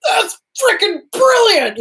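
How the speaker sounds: high, drawn-out exclamation; amazed reaction. Retold or imitated. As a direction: marvel at it slowly, high-pitched. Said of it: "I was just like".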